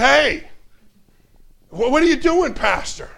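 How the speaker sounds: high, loud startled call; a shocked deacon calling out. Retold or imitated. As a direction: catch him in the act, alarmed. Deacon shocked at pastor's move.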